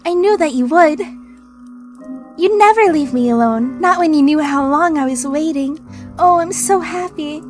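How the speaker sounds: put-on high, eager little girl voice; childish anime girl voice. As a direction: gush like an excited little girl. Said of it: "Childish, I guess?".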